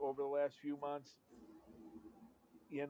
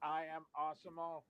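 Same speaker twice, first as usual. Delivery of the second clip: stiff robot announcing itself; 2 semitones higher than usual. Acted. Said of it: "it's the robot".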